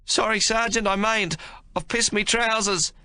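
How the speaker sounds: put-on high, distressed young soldier's voice; distressed confession. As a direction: admit it shamefully to the sergeant. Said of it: "Cooligan says in a distressed voice".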